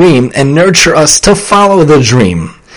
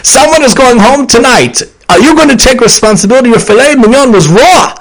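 stern chef; 8 semitones higher than usual. Retold or imitated. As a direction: play a harsh TV chef, hammed-up. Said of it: "Gordon Ramsay".

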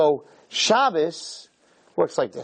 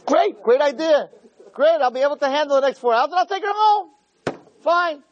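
a hammed-up burst of relief, much higher; giddy relief; 12 semitones higher than usual. Guy's giddy excited self-talk.

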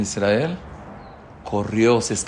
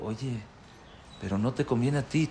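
hushed warning; 9 dB quieter than usual. Retold or imitated. Wife quietly warning husband.

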